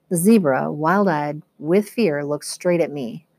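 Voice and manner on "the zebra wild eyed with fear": ordinary delivery, nothing marked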